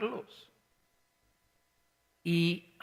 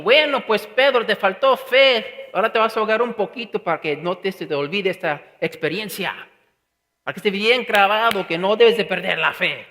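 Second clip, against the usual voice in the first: mock scolding; 2 semitones higher, 8 dB louder than usual. Imagined harsh scolding from Jesus.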